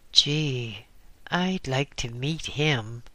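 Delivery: plain reading voice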